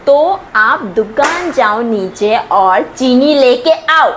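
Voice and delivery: slow, emphatic word-by-word order; exasperated instruction. Acted. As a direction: spell it out with strained patience. Fed-up girl spells out the order.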